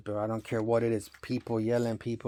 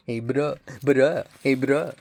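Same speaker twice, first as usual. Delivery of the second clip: imitating the Spaniard; 2 semitones higher, 6 dB louder, slower than usual. Mocking the Spanish guy at the rave, lightly voiced and louder. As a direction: mimic the guy from the rave calling "bro".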